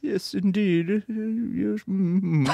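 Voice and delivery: softly hammed-up British murmur; put-on British accent. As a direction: agree in a fussy fake British voice. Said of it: "[name] knows one word in British".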